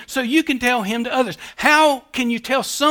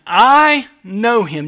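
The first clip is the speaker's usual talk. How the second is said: slow, louder, each word weighted; slow emphatic quote; 5 dB louder, much slower than usual. Acted. Preacher reading Jesus's words, slow and weighty. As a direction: lay down I know him deliberately. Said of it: "Verse twenty-nine".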